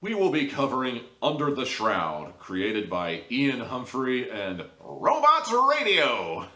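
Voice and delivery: mock announcer, slowing to a drawn-out end; show intro. Reviewer opens his audio drama show, announcer style. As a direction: open the show like a radio host. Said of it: "I just like saying the thing".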